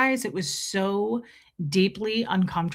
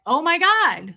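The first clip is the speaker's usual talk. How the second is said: shocked; 7 semitones higher, 5 dB louder, slower than usual. Retold or imitated. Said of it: "we have to flip back".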